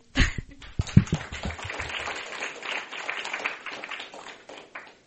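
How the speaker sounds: long breathy laugh; relieved after finishing her share. Real, not acted. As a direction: let out a long nervous laugh.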